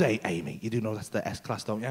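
low, soft imitation of a discreet warning; discreet lean-in. Young salesman leaning in to warn customer, discreet lean-in. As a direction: drop low and quiet, as if having a word. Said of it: "so he just leans in".

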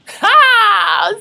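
loud high cackle of laughter; burst of laughter. Real, not acted. Vlogger cracking up at her own tagline attempt, loud laugh.

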